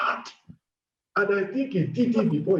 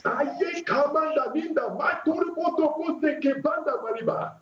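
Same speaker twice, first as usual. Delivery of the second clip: fervent chanted glossolalia; speaking in tongues; 6 semitones higher than usual. Acted. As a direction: pour out the tongues in rising prayer. Prayer leader speaking in tongues.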